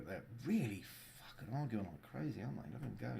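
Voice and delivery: ordinary talk, nothing marked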